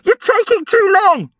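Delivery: put-on whining, high-pitched boy's yell; high agitated shout. A narrator's reading. Brian nagging his brother through the door, agitated. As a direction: complain urgently at a high pitch.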